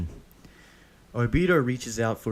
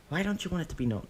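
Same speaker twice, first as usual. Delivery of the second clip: put-on high little boy's question; curious child; 9 semitones higher, faster than usual. A narrator's reading. Child Deidara suddenly asking Obito about his mask, high curious voice. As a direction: ask like a nosy kid. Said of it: "barrage of childish questions".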